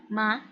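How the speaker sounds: each "ma" short with an upward glide; rising second tone. Shown as a demonstration. Tutor repeats tone two for student, rising. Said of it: "Nada dua ini dia naik ke atas".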